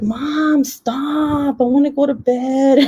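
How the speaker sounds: put-on high whiny voice of a groggy little boy; sleepy kid. Son pleading with mom, high sleepy whine. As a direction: whine like a kid who won't get up. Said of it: "And he was like".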